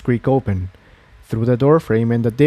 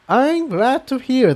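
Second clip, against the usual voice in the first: Quill Dipper voice; 12 semitones higher than usual. A narrator's reading.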